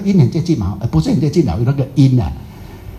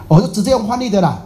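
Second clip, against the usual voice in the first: usual speaking voice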